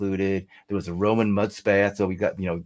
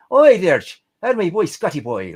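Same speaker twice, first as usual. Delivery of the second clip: broad mock Irish greeting, higher and louder; Irish accent; 10 semitones higher, 8 dB louder than usual. Two Irish friends greeting each other in a hammed-up Irish accent. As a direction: ham up an Irish pub call. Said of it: "we're both Irish".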